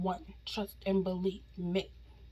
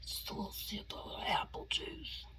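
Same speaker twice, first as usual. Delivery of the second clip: whispering; 6 dB quieter than usual. Real, not acted. Sipping juice while whispering.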